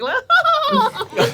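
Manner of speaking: high, loud hammed-up guffaw; gloating royal laugh. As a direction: play a feasting queen laughing at everyone. Queen gloats with wine, turkey leg.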